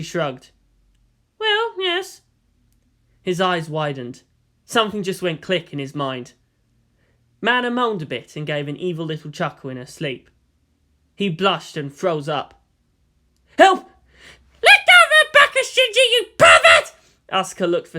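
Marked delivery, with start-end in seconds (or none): 1.4-2.2 much higher, slow teasing woman's voice; Misato voice. Acted. Misato's voice in story. 13.6-16.9 much higher and louder furious shriek; outraged yell. Acted. Asuka yelling at Shinji.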